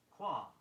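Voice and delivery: Qualm said as kwahm, no L sound; silent L. Shown as a demonstration. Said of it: "an L that isn't pronounced".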